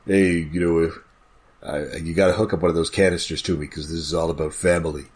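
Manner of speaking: low, soft tough-guy impression; deep-voiced movie hero take-off; Vin Diesel. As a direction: drop deep and quiet, imitating an action star. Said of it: "my, uh, Vin Diesel is just Brad Garrett".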